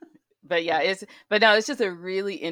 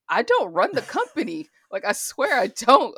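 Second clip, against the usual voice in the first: louder, higher pleading protest; 5 semitones higher, 8 dB louder than usual. Retold or imitated. To frustrated sister on phone, loud pleading protest. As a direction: insist you're innocent. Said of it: "I'm like".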